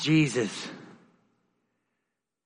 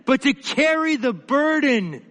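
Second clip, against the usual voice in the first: higher, rising preacher's cry with swells on key words; fervent preaching; 5 semitones higher than usual. Acted. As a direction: preach it out, pleading and lifting the room. Preacher builds to fervent appeal.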